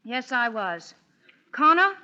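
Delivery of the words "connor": calling out. Acted. Calling out to him.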